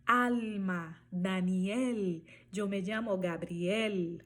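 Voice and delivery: slow Alma, Daniel, Gabriel with clear tongue-tip L; Spanish L. Shown as a demonstration. Teacher inviting learners to pronounce along, clear Spanish L. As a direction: model each L in Alma, Daniel, Gabriel, not as U. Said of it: "pronuncia aí um pouquinho".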